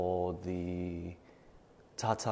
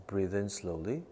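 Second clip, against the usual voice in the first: guided breathing meditation; 11 dB quieter than usual. Acted. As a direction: calmly guide each breath, fading to near whisper. Leads nine-round breathing meditation.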